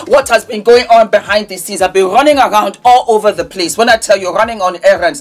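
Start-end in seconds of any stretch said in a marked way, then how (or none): none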